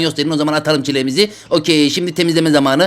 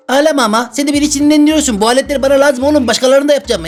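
mock scolding; 7 semitones higher than usual. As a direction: tell the whiny patient off, half-joking. Doctor scolds crying patient.

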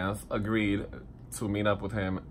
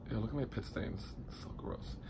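whispered aside; 13 dB quieter than usual. Real, not acted. Aside about his own sweat stains.

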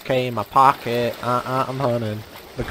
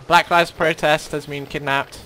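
ordinary talk

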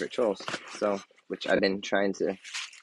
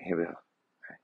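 normal talking voice

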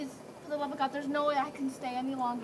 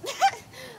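laugh; 10 semitones higher, 5 dB louder than usual. Acted. [name] laughs at the play.

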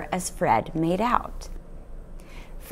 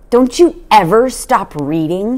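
sharp, irritated father's question; a curt snap from the father; snapped; 5 semitones higher, 4 dB louder than usual. A narrator's reading. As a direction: snap it at her. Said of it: "he snapped at her".

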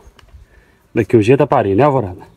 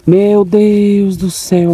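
slow, stretched-out, higher cry of dismay; dismayed exclamation; 9 semitones higher, much slower than usual. Real, not acted. Farmer shocked at his cow Madonna, slow stretched dismay. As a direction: drag the words out in shocked disbelief.

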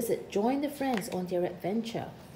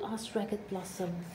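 usual speaking voice

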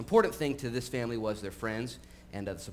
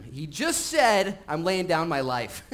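emphatic preaching; 6 semitones higher, 6 dB louder, much slower than usual. Acted. Preacher dramatizes Jesus' claim.

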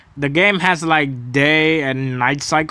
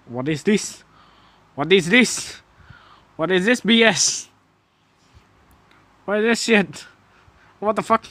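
high-pitched, baffled protest; exasperated disbelief; 6 semitones higher than usual. Real, not acted. Gamer who can't shoot complains at the game, exasperated. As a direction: protest in a raised, incredulous pitch.

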